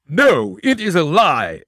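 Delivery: high, loud protest; indignant cry. A narrator's reading. Prisoner denying the detective, loud indignant. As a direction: fling the denial back. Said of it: "exclaimed Jones".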